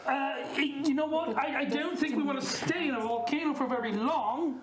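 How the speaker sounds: put-on Australian accent for improv; Aussie accent. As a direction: talk like an Aussie bloke. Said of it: "Keep the accent if you like".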